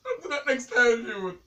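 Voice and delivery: loud, slow, upset protest; bawling. Real, not acted. Pranked partner warning the prankster, bawling loudly. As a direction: wail the warning at full volume. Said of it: "Stop bawling".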